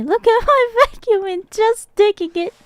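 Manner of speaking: high-pitched, laughing mock brag; mock self-boasting. Streamer parodies showing off their own vacuum, high mock boast. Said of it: "and me".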